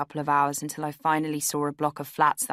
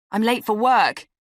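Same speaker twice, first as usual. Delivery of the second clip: loud, higher-pitched accusation; accusatory; 6 semitones higher, 8 dB louder than usual. A narrator's reading. Man just woken blaming his guest, accusatory. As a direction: throw it out loudly as blame. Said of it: "he said accusatorially".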